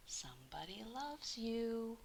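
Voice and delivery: soft breathy whisper of a secret note; whispered message. A narrator's reading. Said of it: "it said".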